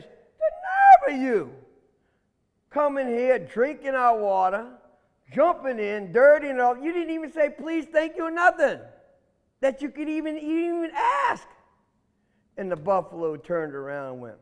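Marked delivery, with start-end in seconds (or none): 0.0-11.5 indignant, high-pitched scolding; mad catfish. Acted. Catfish leader scolds the buffalo for drinking, mad. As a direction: tell someone off, voice climbing. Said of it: "Ooh, that Miko got mad".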